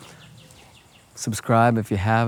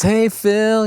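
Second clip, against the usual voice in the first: much higher and louder, bright hello; happy greeting; 11 semitones higher, 5 dB louder than usual. Retold or imitated. Cashier greeting a regular walking in, happily calling out. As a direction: call out gladly to a friend. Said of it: "she's all happy and stuff".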